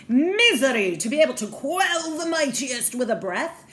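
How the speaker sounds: loud, swooping theatrical rebuke; hammed-up incredulous tirade; indignant villainous father. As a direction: declaim like a grandiose mad scientist. Father grandly rebukes his daughter.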